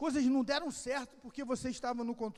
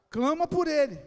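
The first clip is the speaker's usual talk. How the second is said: real preacher's shout, raised and forceful; loud exhortation; 4 semitones higher, 11 dB louder than usual. Real, not acted. Preacher urging congregation to call on God, forceful shout. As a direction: urge them at full voice.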